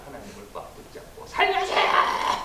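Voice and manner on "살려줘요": high, slow, breathless plea to be saved; mock panicked plea for rescue; desperate cry for help. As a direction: wail it like a drowning man begging. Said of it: "우리 그냥 흔히 하는 말로 하면".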